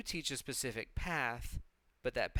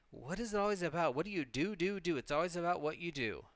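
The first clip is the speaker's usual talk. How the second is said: upset outburst; 7 semitones higher, 3 dB louder than usual. Retold or imitated. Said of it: "gets really upset and says".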